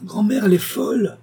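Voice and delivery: put-on a boy crying out to his grandmother in fright; high frightened cry. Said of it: "sa voix aiguë cria".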